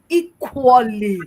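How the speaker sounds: "equally" drawn out and punched hard; stress on EQUALLY. Real, not acted. Speaker tells host man and woman are equal, stressing "equally". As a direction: hit "equally" slowly, each syllable weighted. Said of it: "You see how I emphasize the word equally".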